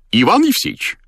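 high-pitched hail; doctor calling out. A narrator's reading. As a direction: call to someone on the road. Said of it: "обратился к нему доктор".

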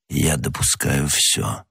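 slow, low, soft answer; grim restraint. A narrator's reading. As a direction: answer slowly, holding back emotion. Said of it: "стараясь подавить невольный вздох". (медленно, тихо, сдержанно)

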